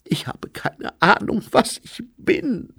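high, breath-laden sigh, slowing at the end. A narrator's reading. Lady Lejeune admitting she doesn't know herself, high sighing voice. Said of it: "seufzte Lady Lejeune".

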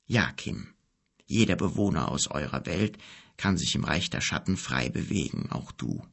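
a low-voiced wise wizard explaining gently; old wizard. A narrator's reading. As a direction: speak as the grave old wizard, low and calm. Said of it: "wizard reassures".

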